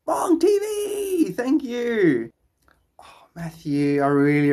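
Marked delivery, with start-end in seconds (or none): 0.1-2.3 high, stretched-out squeal of thanks; gleeful drawn-out cheer for a supporter; excited shout-out. Real, not acted. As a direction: draw the name out in a delighted high cry. Greeting a donor on stream.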